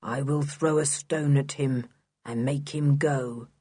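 low, toneless threat; expressionless character voice. A narrator's reading. Block offering to stone the dog, expressionless. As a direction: speak low and without any expression.

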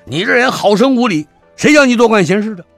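high, loud, fast bullying yell; haughty young master shouting, high and fast; arrogant shout. Acted. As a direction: bark it down at him like a spoiled young lord. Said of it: "还在喊话呀".

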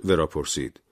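plain reading voice

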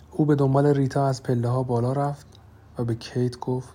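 ordinary delivery, nothing marked

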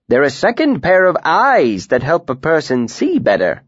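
a cheerful, lively tinker. A narrator's reading.

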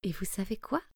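quiet tender storyteller finish; tender ending. A narrator's reading. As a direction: confide the happy ending. Gentle ending to listener.

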